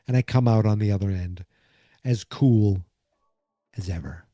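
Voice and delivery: ordinary talk, nothing marked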